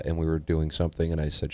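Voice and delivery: ordinary talk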